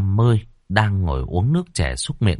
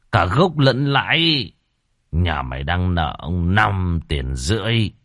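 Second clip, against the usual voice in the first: scheming landlord; 2 semitones lower, slower than usual. Acted. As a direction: lay out the trap at leisure. Landlord sets terms slowly. (lão bá hộ tính toán chậm rãi)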